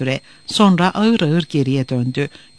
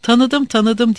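eager; 7 semitones higher than usual. A narrator's reading. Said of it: "diye atıldı".

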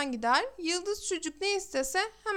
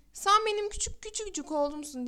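higher, pleading cry of a mother; anguished cry; 5 semitones higher than usual. Acted. Mother crying out to her rejecting son, anguished plea. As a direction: reach out to him with a heartbroken cry. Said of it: "diye haykırdı kadın".

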